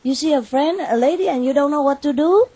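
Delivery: high, incredulous question; asking in mock disbelief. Retelling asking the driver, mock incredulous. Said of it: "I said".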